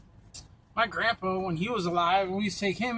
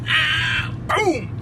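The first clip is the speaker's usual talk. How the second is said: loud, high yelp then a crash sound; hammed-up yell and boom; mock shout; 9 semitones higher, 4 dB louder than usual. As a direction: act out the jolt and the fall. Acting out a tased man falling.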